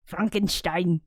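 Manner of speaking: German-style "Frankenstein", with "sh" before the t and an open "ah"; attempted German accent. Shown as a demonstration. Speaker unsure how to say Frankenstein in German, attempted German accent. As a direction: try "Frankenstein" as a German would, "Frahn-ken-shtein". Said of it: "in German accent".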